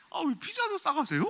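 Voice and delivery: very high, loud mocking question; laughing mockery. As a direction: imitate a date laughing in disbelief. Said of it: "막 이렇게 웃더라고요". (웃음 섞인 높은 목소리로 놀리는 흉내)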